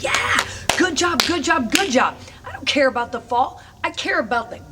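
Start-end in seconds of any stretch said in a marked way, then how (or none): 0.0-2.2 praise. Retold or imitated. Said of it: "I'm like".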